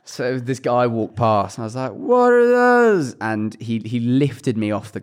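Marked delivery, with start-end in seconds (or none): 1.9-3.2 high, drawn-out yell; meme shout. Retold or imitated. Drunk student heckling a guy walking past, drawn-out shout. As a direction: holler it long and high at a passer-by. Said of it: "I was shouting it at people".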